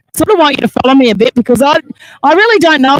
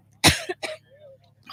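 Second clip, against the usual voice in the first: coughing from dust in the throat; 16 dB quieter than usual. Real, not acted. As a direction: cough through the words, throat full of dust. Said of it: "This dust is getting down my throat".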